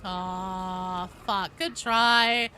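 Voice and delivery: ordinary talk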